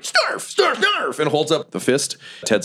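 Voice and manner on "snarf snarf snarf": very high squeaky goblin snarf. Acted. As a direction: snarf like a tiny excited goblin. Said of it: "Goblin Junior is like".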